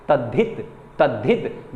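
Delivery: तद्धित said carefully, द and ध both clear; pronunciation demo. Shown as a demonstration. Teacher showing students how तद्धित is pronounced, careful and clear. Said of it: "same ऐसे उच्चारित होगा".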